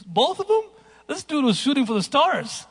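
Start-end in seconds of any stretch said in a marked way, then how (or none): 0.0-0.6 amazed question. Retold or imitated. Father reacting to son's wish list, higher and amazed. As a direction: sound playfully astonished at the request.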